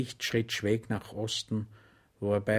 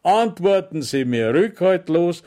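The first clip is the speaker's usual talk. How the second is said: much higher, louder sugary welcome; sergeant's mock welcome; 5 semitones higher, 9 dB louder than usual. Sergeant welcoming Schweig with exaggerated friendliness, higher and louder. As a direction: greet him with false warmth.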